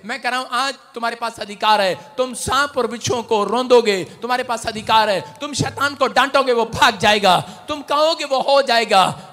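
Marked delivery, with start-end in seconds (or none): 2.2-9.3 loud, rising sermon voice; fiery preaching. Acted. Pastor rousing the congregation, loud and fervent. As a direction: preach it out full force to the crowd.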